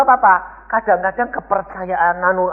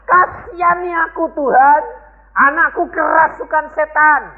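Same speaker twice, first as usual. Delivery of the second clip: pleading cry; 12 semitones higher than usual. Retold or imitated. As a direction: cry out high for help. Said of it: "Perempuan ini teriak-teriak".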